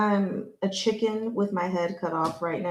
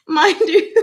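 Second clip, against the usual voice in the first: embarrassed laugh; 13 semitones higher, 4 dB louder than usual. Real, not acted.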